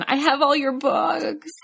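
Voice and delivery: high, slow, starstruck little voice; gushing nervous fan. Retold or imitated. Nervous fan blurting to the famous witch, high and slow.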